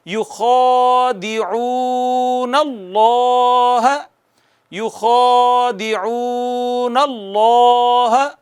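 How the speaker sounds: very slow chant of یخادعون اللہ, each long vowel held; long vowels stretched. Shown as a demonstration. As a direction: let every madd in یخادعون ring out. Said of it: "proper کھینچیں گے تو اتنا خوبصورت ہو جائے گی قرأت".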